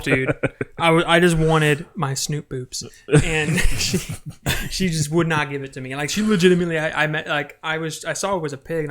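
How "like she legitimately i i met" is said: usual speaking voice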